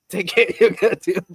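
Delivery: loud, high-pitched retold outburst; hammed-up exasperated telling-off of a parent; mock scolding.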